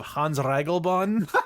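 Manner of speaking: put-on German accent only on the name. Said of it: "the only thing you say in a German accent is his name".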